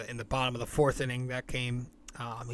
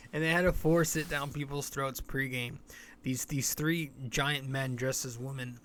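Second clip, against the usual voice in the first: normal talking voice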